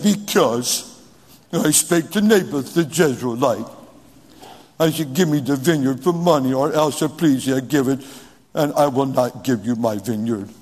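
low, sulky pouting king; pouting man. Acted. Pouting king moaning to his wife about a vineyard, low mock sulk. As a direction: mope it out like a pouter. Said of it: "Men don't talk like that".

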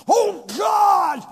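loud, high shout at God; mocking a frustrated believer's tantrum with a high shout; temper tantrum. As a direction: throw a fit at heaven. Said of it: "And you come".

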